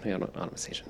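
muttered under the breath; hushed self-correction; whispered aside. Real, not acted.

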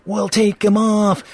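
high, slow heckler's threat; mock bully threat. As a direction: play-act a thug, drawing the words out. Bullies threatening Cyclops, acted out.

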